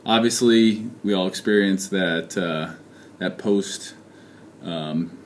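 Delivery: normal talking voice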